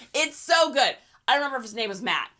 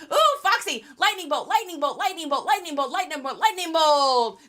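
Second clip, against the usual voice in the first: loud, high rapid-fire chant; loud mock battle cry, repeated and insistent; larper casting spells; 6 semitones higher, 7 dB louder than usual. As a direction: hurl spells like an over-eager role-player. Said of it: "That's my larping".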